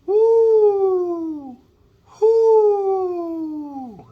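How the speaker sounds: high falsetto woo like an owl; high owl hoot. Shown as a demonstration. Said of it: "sound like an owl".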